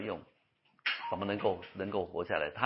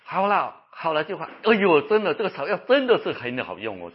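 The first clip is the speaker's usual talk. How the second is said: gushing praise; 5 semitones higher, 6 dB louder than usual. Retold or imitated. Recovered patient praising herb again.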